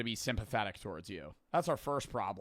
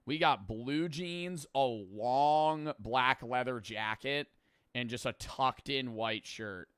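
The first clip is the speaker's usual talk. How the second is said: drawn-out roast of the outfit; slow mocking list; slower than usual. Real, not acted. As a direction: list each item slowly, judging.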